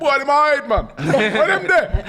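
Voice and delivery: playful high-pitched Jamaican accent impression; mock Jamaican accent. Pundit jokingly redoes the rant in a Jamaican accent. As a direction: ham up a Jamaican accent, laughing through it. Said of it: "maybe a Jamaican accent".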